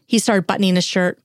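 plain reading voice

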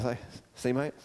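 low, soft, understated remark; Paul's quiet aside. Acted. Paul to Silas after the baptisms, low quiet aside. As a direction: murmur it low to your mate. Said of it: "I just imagine Paul sort of going to Silas".

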